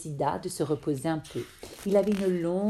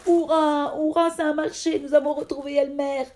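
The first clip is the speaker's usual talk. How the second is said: much higher, louder joyful cheer; 9 semitones higher, 5 dB louder than usual. Acted. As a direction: celebrate with the whole herd. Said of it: "les éléphants s'acclamèrent".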